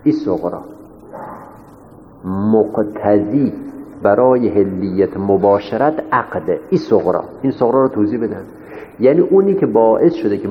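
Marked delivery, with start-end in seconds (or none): 2.2-6.6 measured, word-by-word statement; slow dictation. Real, not acted. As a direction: spell out the premise slowly for students to follow. Teacher dictates the minor premise. (آهسته و شمرده، مثل دیکته گفتن)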